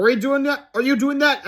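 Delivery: acted bewilderment; baffled question. A narrator's reading. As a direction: ask in excited confusion. Baffled characters in flight.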